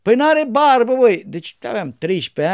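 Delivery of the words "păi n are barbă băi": put-on loud, high gruff old monk; old monk's teasing. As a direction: tease the boy loudly. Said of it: "Și el spunea".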